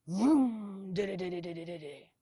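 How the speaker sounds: imitated revving engine and rumble; engine vroom. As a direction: make the truck roar then putter.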